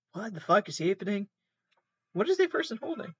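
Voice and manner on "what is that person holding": high, quick, disbelieving question; baffled. Real, not acted. Seeing someone holding an odd weapon, high and baffled. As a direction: blurt it out, thrown by what you see. Reacting to a strange video.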